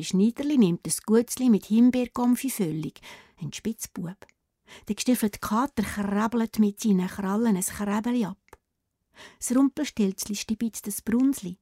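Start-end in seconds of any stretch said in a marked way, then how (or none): none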